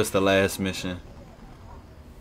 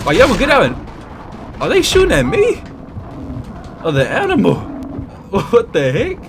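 alarmed yelling; 10 semitones higher than usual. Real, not acted. Being shot at by enemies.

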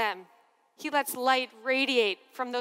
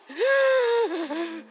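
emotional gasp; 6 semitones higher, 4 dB louder than usual. Retold or imitated. Overwhelmed priest answers crowd.

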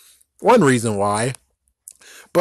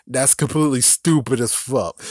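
ordinary delivery

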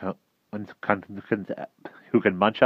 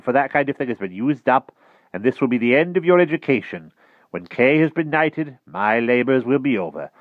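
put-on higher, louder old tutor; the wizard's farewell lecture; 7 semitones higher, 7 dB louder than usual. A narrator's reading. Merlin voiced in read story.

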